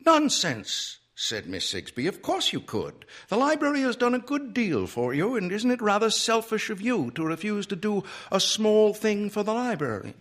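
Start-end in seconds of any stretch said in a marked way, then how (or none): 0.1-1.0 high, loud librarian dismissal; high-pitched firm contradiction; brisk dismissal. A narrator's reading. Librarian dismisses Ed's refusal. 2.1-10.1 high-pitched, reproachful lady librarian; high, prim and pressing; insistent prim librarian. A narrator's reading. Librarian figure persuading Ed.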